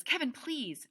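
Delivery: high-pitched embarrassed plea; small, embarrassed voice. A narrator's reading. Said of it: "her voice is real small, like she's embarrassed".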